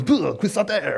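a dismissive blah, then the name said in French; lightly imitated French scorn; mock French accent. Pastor mimicking critics in a light French accent. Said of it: "those French people".

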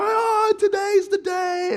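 very high, loud, slow joyful cry; excited shout. Retold or imitated. Kids in the backseat cheering at dad, high excited shouting. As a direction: kids celebrating the big day. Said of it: "we're just shouting up at my dad".

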